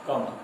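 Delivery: "कमल" with the most weight on म; teacher's demonstration of stressed म in "कमल". Shown as a demonstration. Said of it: "उससे अधिक जोर म पर है".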